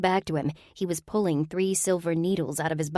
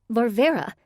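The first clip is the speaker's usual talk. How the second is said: higher, louder startled gasp of a name; 6 semitones higher, 4 dB louder than usual. Acted. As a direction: blurt the name in shock. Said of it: "Nadine gasped".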